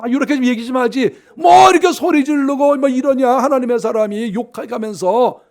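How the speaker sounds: loud, high mock scolding; complaining people. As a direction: imitate people fed up with a shouting prophet. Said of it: "이렇게 지금 백성들이 얘기를 하는".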